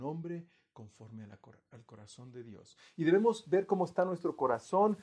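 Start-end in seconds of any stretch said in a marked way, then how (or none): 0.7-2.8 much softer, almost breathed, a hushed repeat; near-whispered echo. Acted. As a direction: drop it to a hush. Repeats the phrase about David's heart. (eco casi susurrado)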